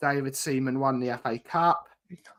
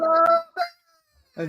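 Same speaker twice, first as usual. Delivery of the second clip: a far higher squeaky mock greeting; falsetto hello. Reacting to the final game.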